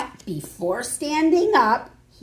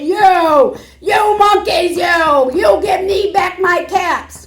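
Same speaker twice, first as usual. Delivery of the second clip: much higher, louder furious yell; angry shout; 7 semitones higher, 8 dB louder than usual. Acted. Really angry peddler shouting for his caps. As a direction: bellow at the monkeys. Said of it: "he shouted".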